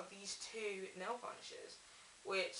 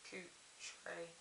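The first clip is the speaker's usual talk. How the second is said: quiet correction; 12 dB quieter than usual. Real, not acted. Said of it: "It's not even say Culture".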